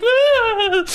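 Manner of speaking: high, loud silly damsel scream; mock scream. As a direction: let out a sustained hammed-up shriek. Imitates the nurse falling over.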